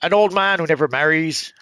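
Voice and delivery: ordinary talk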